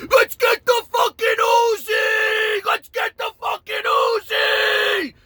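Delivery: very high, drawn-out loud yell; wild hollering. Retold or imitated. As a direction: holler slowly, stretching the last word. Imitates friend yelling for gun.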